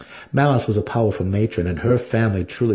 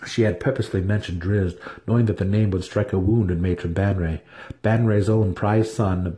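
usual speaking voice

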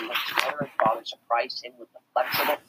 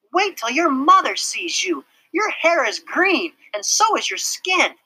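put-on high, louder tattling girl; gleeful schoolgirl warning; bossy girl; 5 dB louder than usual. A narrator's reading. Girl voiced, Mary Jane.

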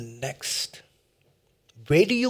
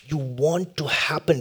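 ordinary delivery

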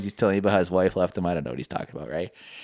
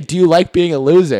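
loud teasing question; mock taunt; 5 semitones higher, 7 dB louder than usual. Friend retelling the taunt, loud and teasing. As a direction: needle him. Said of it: "you kept saying".

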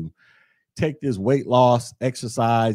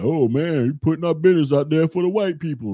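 mock complaining critic; 7 semitones higher, 5 dB louder than usual. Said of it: "some people be like".

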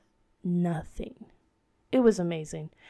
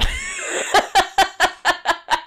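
high burst of real laughter; real laughter at the memory; loud laugh; 9 semitones higher, 5 dB louder than usual. Real, not acted. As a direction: crack up out loud. Laughing at the moment.

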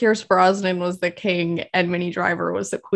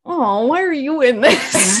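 higher, louder, drawn-out mock dismay; 5 semitones higher, 5 dB louder than usual. Film fan replaying dismay at an actress showing up, drawn-out mock dismay. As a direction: stretch it into an amused lament.

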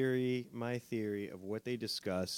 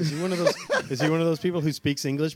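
laughing; 6 semitones higher, 10 dB louder, much faster than usual. Real, not acted. Laughing while asking.

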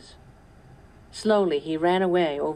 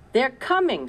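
excited; 9 semitones higher, 6 dB louder than usual. A narrator's reading. Caleb watching from the window.

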